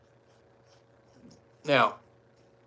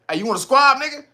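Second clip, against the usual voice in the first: higher-pitched playful challenge; mock fight challenge; 10 semitones higher than usual. Kid from LA challenging another to squabble, playfully confrontational. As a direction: call someone out to fight, half laughing.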